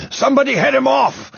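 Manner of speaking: breathless high shout; urgent directing. A narrator's reading. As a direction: shout an order after pausing for breath. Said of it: "he directed".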